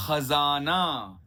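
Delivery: खजाना very slow, throaty ख and a z sound; slow nukta kh and z. Shown as a demonstration. Teacher slowly demonstrating खजाना. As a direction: drag खजाना out with the Urdu x and z. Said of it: "अगला है".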